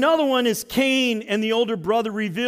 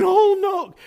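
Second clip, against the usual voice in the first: the ground crying out; 11 semitones higher, 4 dB louder than usual. Pretend. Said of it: "It was saying".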